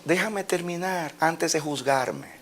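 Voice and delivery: calm and patient; gentle rebuke. Acted. God answering the complaining believer, softly patient. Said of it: "dice Dios".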